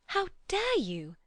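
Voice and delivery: a sharp gasped high cry; stunned, breath-caught outrage. A narrator's reading. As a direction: gasp it out in shock, slowly and high. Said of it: "gasped Lucy".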